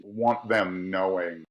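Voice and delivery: ordinary talk, nothing marked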